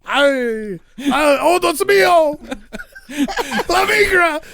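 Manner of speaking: put-on high, loud, panicky Spanish accent. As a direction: cry it out in a mock Spanish accent. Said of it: "I just put on my best Spanish accent".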